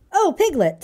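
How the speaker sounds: high, excited greeting. A narrator's reading. Pooh finding Piglet at his door, excited. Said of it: "said Pooh excitedly".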